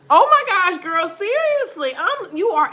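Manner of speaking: high, loud excited agreement; real excitement, voice jumped up high. Real, not acted. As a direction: gush in full agreement.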